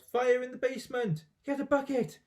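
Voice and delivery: high, frantic alarm calls; grandma on fire, frantic high yells; panicked yelling. Acted. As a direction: bark orders in a panic. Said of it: "the old woman yelled".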